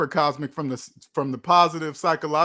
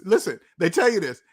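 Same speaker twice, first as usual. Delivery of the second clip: normal talking voice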